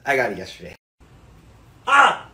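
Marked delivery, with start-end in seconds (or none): 1.9-2.3 loud sudden shout to scare; startling boo. Acted. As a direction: jump out and yell. Jump scare on a friend.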